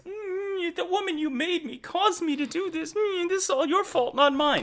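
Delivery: high-pitched mock blame-shifting voice; hammed-up, squeaky excuse-making; Adam. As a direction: whine it way up high, passing the blame. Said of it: "where Adam says".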